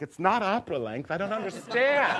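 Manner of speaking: high, loud bewildered protest; mock dismay. Son baffled by a baseball glove, dramatic dismay. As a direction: climb to a theatrical wail.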